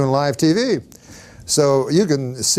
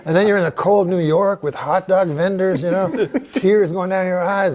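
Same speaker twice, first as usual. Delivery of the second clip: ordinary delivery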